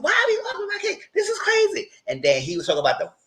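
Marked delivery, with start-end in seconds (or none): 0.0-1.9 much higher squeaky voice, imitating Gemini; high mock outburst. Retelling Gemini griping at the arguing guests, in a high squeaky mock voice. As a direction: squeak it out like a fed-up partygoer.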